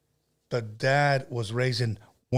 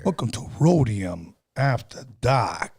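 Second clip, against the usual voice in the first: radio host voice; 4 semitones lower, slower than usual. Acted. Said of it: "you have to have the Boston accent".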